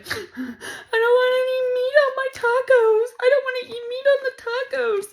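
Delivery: very high, loud mock bawling; hammed-up tearful shriek; crying wail. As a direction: wail it like a crying sleeper just woken. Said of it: "And I was crying".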